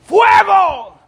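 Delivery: sudden high shout of alarm; shouting fire. Retold or imitated. Teacher shouting to his youth class. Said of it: "cuando yo grito".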